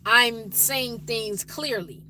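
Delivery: slow, carefully separated words; deliberate, articulate delivery; clear speech. Shown as a demonstration. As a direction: say each word clearly and unhurriedly. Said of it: "when I'm talking like I'm talking now".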